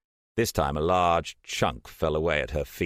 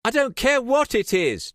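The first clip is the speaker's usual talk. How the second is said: much higher, louder indignant cry; outraged; 6 dB louder than usual. A narrator's reading. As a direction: Trish furious at the damage.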